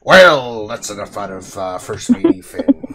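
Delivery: loud pirate captain's bark. Pretend. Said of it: "the pirate voice".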